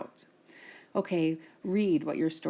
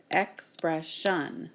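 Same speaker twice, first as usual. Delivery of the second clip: ex-pres-sion, each syllable of "expression" drawn out slowly; slow, split into syllables; 3 semitones lower, much slower than usual. Shown as a demonstration. Teacher slowly claps syllables of "expression" for students. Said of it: "We hear three syllables".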